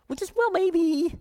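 high-pitched, noncommittal maybe; mock an unsure, wishful hoper; tentative. Preacher mimicking wishy-washy hope for the congregation, tentative.

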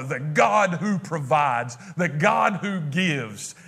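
slow, each word weighted; slow stress on God; solemn proclamation. Real, not acted. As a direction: proclaim it slowly with reverence. Preacher proclaims God's names.

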